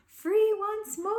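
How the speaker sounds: high, loud cry of freedom; triumphant. Acted. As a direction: burst out with joy. Beast escapes.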